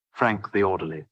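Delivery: ordinary delivery, nothing marked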